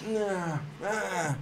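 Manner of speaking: high, drawn-out mock moans; acting hurt. Mocking staged wrestling pain, high feeble fake moans. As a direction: fake being hurt, half-hearted and whiny. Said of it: "acting like I'm getting hurt".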